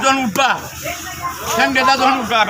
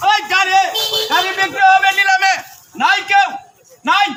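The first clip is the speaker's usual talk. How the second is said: auctioneer; 5 semitones higher than usual. Acted. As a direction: call out the bids like an auctioneer. Auction calling for bids.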